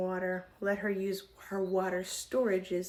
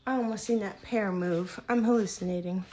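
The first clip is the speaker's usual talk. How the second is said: ordinary delivery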